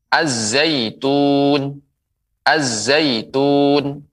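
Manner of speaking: very slow "az-zaitun", held z and long u; doubled z. Shown as a demonstration. Quran teacher models the letter zai, doubled z. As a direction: draw out "Az-zaitun", l silent. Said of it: "Nah, begitu".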